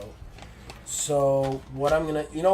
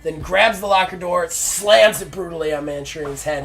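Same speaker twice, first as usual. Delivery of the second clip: louder, raised-pitch fight narration; excited play-by-play; 4 semitones higher, 7 dB louder than usual. Pretend. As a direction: hit each blow hard as the attack unfolds. Narrates the backstage attack.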